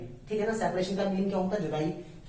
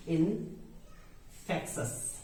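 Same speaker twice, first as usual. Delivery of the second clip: Infectious broken up with a long gap, softer; slow syllable demonstration; 6 dB quieter, much slower than usual. Shown as a demonstration. Teacher models the term for learners, slow and soft. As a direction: say in... fek-shus slowly for infectious. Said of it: "क्या बोलते हैं हम".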